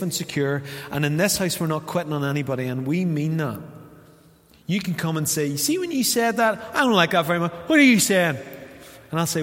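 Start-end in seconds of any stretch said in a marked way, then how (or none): 5.5-8.4 higher and louder, offended and demanding; wounded, rising confrontation; indignant challenge. Retold or imitated. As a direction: confront him with the hurt. Hurt person confronts the speaker.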